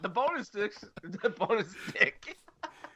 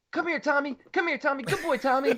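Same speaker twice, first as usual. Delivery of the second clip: high, coaxing call to a dog, getting louder; imitating Gordon's owner; 8 semitones higher, 3 dB louder than usual. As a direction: call the dog sweetly, then call out. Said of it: "the last line is him going".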